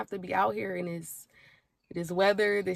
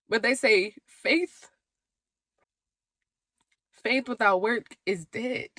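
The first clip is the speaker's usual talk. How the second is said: slow sermon-like; slower than usual. Real, not acted. Quoting scripture slowly.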